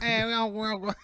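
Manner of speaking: very high, loud teasing voice; squeaky mock voice. Friend teasing in a high mock voice. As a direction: ham it up, sassy.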